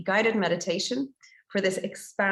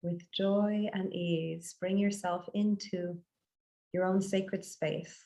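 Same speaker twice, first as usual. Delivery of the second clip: guided meditation; 4 dB quieter than usual. Acted. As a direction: guide listeners gently inward, phrase by unhurried phrase.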